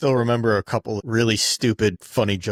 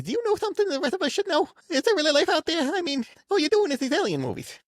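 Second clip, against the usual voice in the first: much higher, hammed-up host voice; high mock Leno voice; faster than usual. Host grilling Will Smith in a high mock voice. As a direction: squeak it out like a caricatured host.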